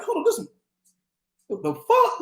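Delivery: a stunned high-pitched "what the fuck"; high astonished squeak. Retold or imitated. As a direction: squeal it like you can't believe your eyes. Sees himself floating near the fan.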